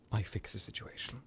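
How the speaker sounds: soft, near-whispered vow; quiet and breathy; hushed resolve. Acted. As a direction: drop it almost to a whisper. Vampire vows to fix things.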